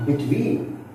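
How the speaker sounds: "Between" rising onto a strong TWEEN; stress on second syllable. Shown as a demonstration. Teacher listing prepositions, second-syllable stress. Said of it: "we give stress on the second syllable".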